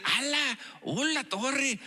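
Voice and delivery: saludo más agudo, voz de otro; saludo imitado. Retold or imitated. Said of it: "se escucha que dicen". (saludo imitado más agudo)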